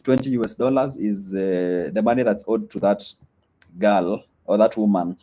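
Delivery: normal talking voice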